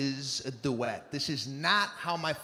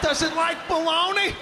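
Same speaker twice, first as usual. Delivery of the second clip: high, loud sly question; sly mock question; 12 semitones higher, 6 dB louder than usual. Family teasing the neighbor, high and loud. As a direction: ask it up high like a sly joke.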